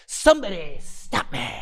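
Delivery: a lightly voiced Jim Carrey line; Jim Carrey impression. Imitating Jim Carrey for friends, mild impression. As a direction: channel Jim Carrey's wacky punch, only lightly. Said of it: "I got it so good".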